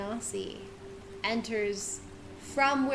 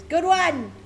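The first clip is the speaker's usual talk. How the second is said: bright mock quip, higher and louder; NPC quip; 7 semitones higher, 8 dB louder than usual. She jokes back at the player. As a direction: toss off a cheeky good one. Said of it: "and she goes".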